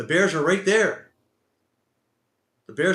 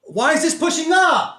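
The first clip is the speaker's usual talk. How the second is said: high, loud outraged question; real frustrated shout; exasperated yell; 11 semitones higher, 6 dB louder than usual. Real, not acted. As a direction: yell in disbelief as it turns against you. Yelling at market turning against him.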